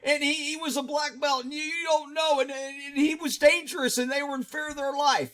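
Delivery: high, hurried whiny mimicry of critics; mocking objectors. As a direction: parrot the excuse-makers in a high put-on voice. Mocks viewers who defend the police.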